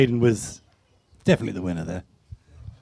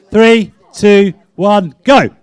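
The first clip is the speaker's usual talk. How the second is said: loud, punchy countdown to the start; contest countdown; 2 semitones higher, 8 dB louder, much slower than usual. Real, not acted. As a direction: call out the start of a race.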